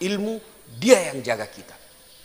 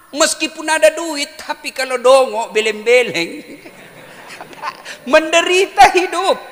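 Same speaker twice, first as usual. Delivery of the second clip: loud mocking moral; 12 semitones higher, 10 dB louder than usual. Real, not acted.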